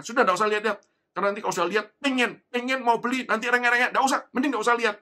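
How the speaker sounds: loud, high, rattled-off refusal; scolding parent. Retold or imitated. Parent warning child off the toy shop, loud scolding. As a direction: imitate a parent cutting off a child's wish.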